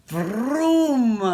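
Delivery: a sudden loud, high vroom for the toilet blast; loud mock blast. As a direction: belt out vroom like a roaring engine.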